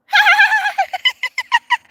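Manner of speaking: high shrill imitated giggle; mock girlish giggle. Coworker telling viewers about a giggling young girl with a mock giggle. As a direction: mimic a silly girl giggling. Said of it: "all I heard was".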